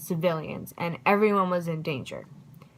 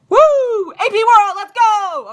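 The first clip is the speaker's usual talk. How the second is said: loud high cheer; 14 semitones higher, 11 dB louder, slower than usual. Real, not acted. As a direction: hype up the class. Cheering for the class.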